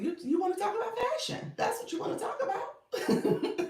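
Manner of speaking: high, laughing playful tease. Real, not acted. Host teasing a shy viewer about fashion, high and laughing. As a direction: giggle through the words.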